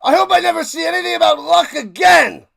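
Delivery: high, loud, fast furious yell; angry shout on request. Acted. Told to say it again, he yells his grudge loud and high. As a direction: bellow it like you mean it, way up high. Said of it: "Well, then say it again".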